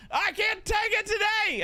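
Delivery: high mock wail of a fed-up wife; exasperated wife. Wife fed up with his teasing, high mock wail. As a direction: squeal it like she's had enough. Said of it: "She's like".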